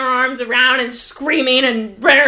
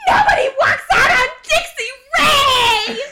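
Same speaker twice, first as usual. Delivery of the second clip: much higher, laughing, shouted mock outrage; mock diva scream; 12 semitones higher than usual. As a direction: scream it like a wronged femme fatale. Quoting Dixie's big exit scream.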